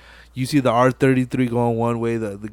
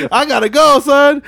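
hyped getaway line; 13 semitones higher, 7 dB louder than usual. Retold or imitated. Toretto fleeing cop.

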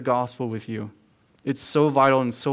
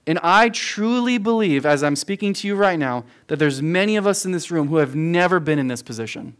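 normal talking voice

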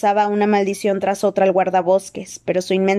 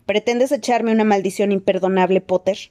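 plain reading voice

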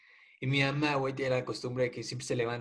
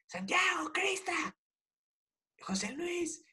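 much higher mock yell of mom calling the kids; mock shrill yell; slower than usual. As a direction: pitch it way up like a mom yelling from downstairs. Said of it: "gritó más fuerte".

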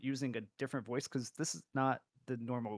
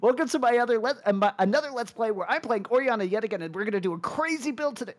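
much higher, louder hyped host; YouTube intro voice; 9 semitones higher, 8 dB louder than usual. Retold or imitated. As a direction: tease a friend with streamer hype. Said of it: "like, YouTube intro of".

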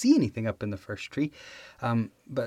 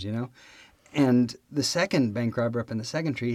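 ordinary talk

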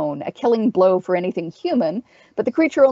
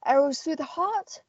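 higher-pitched captain's voice; sighing captain; 7 semitones higher than usual. A narrator's reading. Owl asking the witch how to kill them, sighing. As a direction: question her with weary practicality, pitched up. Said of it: "The owl sighed".

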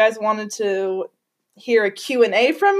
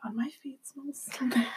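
whispering; 3 semitones higher, 11 dB quieter than usual. Real, not acted. Whispered complaint about smelly feet.

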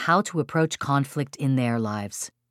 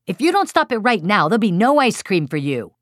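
raised-voice parental threat; threatening; 5 semitones higher, 4 dB louder than usual. Shown as a demonstration. Parent threatens child with no ice cream, raised voice. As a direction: warn a child with a high, insistent threat. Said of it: "You've avoided threatening".

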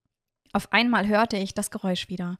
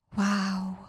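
awed, escaping wow. A narrator's reading. Miorin seeing the sunrise over the forest, hushed awe. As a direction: let it slip out in wonder. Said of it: "entwich es mir".

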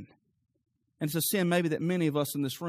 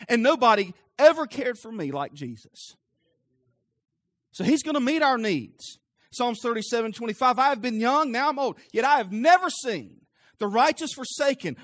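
raised, higher preacher's delivery; emphatic sermon voice, driving each point home; impassioned preaching; 7 semitones higher, 6 dB louder than usual. Real, not acted. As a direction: preach it forcefully to the whole room. Preaches at full force.

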